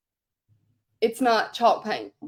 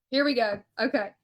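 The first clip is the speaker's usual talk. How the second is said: usual speaking voice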